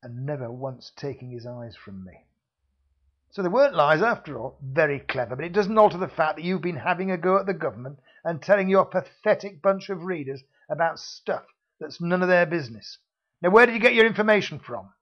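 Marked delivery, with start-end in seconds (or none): 3.3-14.9 louder, faster hectoring thug; loud hectoring security man; bullying interrogation. Acted. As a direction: drive the question home. Villain interrogates hero.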